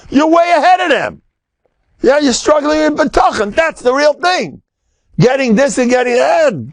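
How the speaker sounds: fervent high preaching voice; high rousing exhortation. Real, not acted. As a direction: lift it high to rouse them.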